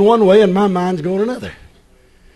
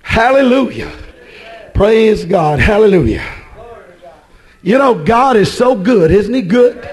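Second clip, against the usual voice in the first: usual speaking voice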